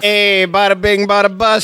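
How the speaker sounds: cartoon Italian New York accent; mock Italian accent. Mocking stereotype accents.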